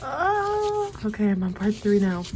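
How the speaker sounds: usual speaking voice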